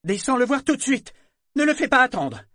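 crié, plus aigu et plus fort; presse-le de descendre; ordre pressant. Acted. Femme ordonne à Yémin. (ordre pressant)

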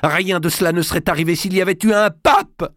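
high, loud furious cry; angry outburst. Acted. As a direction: blurt out the blame in fury. King bursts out in anger. (cri de colère, aigu et fort)

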